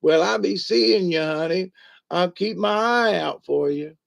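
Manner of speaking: high granny farewell, sly on the last line; high-pitched granny with a wink; old granny voice. Retold or imitated. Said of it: "she waves a little hand and she goes".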